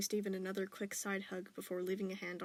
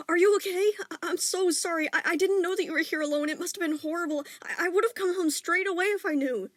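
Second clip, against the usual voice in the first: high, loud, stammering distress; frantic apology; 10 semitones higher, 13 dB louder than usual. A narrator's reading. Father apologizing to his son, loud and frantic. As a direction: blurt out a panicked apology.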